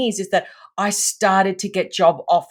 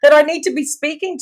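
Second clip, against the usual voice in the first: rising and louder, urgent worried counting; panicked inner voice; 7 semitones higher, 5 dB louder than usual. Retold or imitated. Thinking to herself in the meeting, anxious and rising. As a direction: pressure building with every repeat of the deadline.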